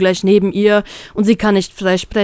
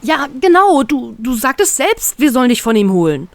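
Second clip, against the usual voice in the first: raised voice; 5 semitones higher than usual. Acted. Penguin backing Beppo up, raised voice. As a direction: press the point hard. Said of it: "mit erhobener Stimme".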